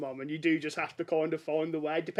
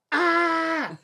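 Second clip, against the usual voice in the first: put-on child's yelp; 12 semitones higher, 7 dB louder than usual. Child retold yelling to Alexa.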